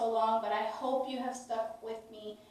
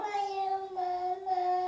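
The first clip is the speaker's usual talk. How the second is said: put-on higher, slow, drawn-out model for a toddler to copy; slow modelled phrase; 10 semitones higher, much slower than usual. Shown as a demonstration. Mother modelling words slowly for her toddler to copy. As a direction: stretch each word so he can copy it. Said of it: "Can you say, "Love you, Mama"?".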